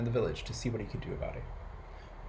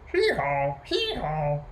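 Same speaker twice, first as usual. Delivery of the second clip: two-note hee-haw bray; donkey braying; 3 semitones higher, 3 dB louder, slower than usual. A narrator's reading. Storyteller voicing the donkey next morning, braying. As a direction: imitate a donkey, twice over. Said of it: "He heard the donkey going".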